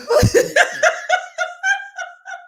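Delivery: long, high-pitched helpless laugh; high wheezy cackling laughter; real cackle. Real, not acted. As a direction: crack up completely. Cracking up at own joke.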